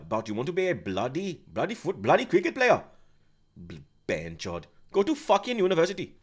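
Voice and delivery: mock Pakistani dad telling his son to study.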